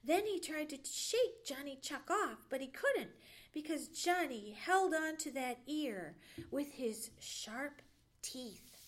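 usual speaking voice

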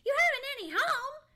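high, loud, fast astonished echo; incredulous. A narrator's reading. Squirrel echoing Johnny's news, incredulous. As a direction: squeak it back in disbelief. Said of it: "didn't think he had heard that aright".

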